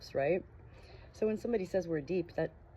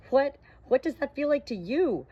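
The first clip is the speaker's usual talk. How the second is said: higher, stammering and amazed; stuttered question; 10 semitones higher, 8 dB louder than usual. Acted. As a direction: trip over the first word in wonder. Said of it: "I finally stuttered".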